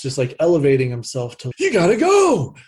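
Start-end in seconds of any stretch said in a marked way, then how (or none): 1.6-2.6 imitating a yelling coach, much higher and louder; retold shout urging players on; mock coach yell, pitched way up; raised coach voice. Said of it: "raising the voice".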